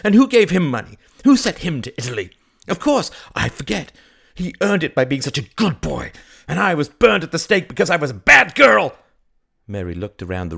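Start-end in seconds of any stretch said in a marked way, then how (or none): 0.0-9.0 loud, high, sarcastic tirade; furious resentment; bitter outburst. Acted. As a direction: rant with mock-sweet scorn, building to a shout. Bitter quarrel about favoured brother.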